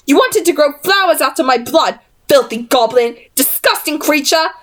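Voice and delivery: a touch of high, contemptuous spite; spat insults. A narrator's reading. Jessamine spits at the goblin, mildly contemptuous. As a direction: spit the words in disgust. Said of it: "Jessamine spat".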